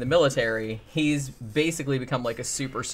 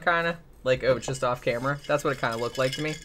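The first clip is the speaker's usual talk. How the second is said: ordinary talk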